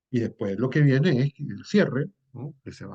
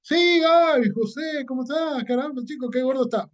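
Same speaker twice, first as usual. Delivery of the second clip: voz muy aguda de amigo efusivo; 13 semitones higher than usual. Retold or imitated. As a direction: saludar con entusiasmo exagerado. Segundo amigo responde en el metro. (el otro amigo responde a José, muy efusivo)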